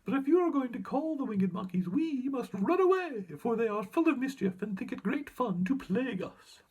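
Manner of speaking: squeaky mouse queen. A narrator's reading. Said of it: "replied the Queen of the Mice".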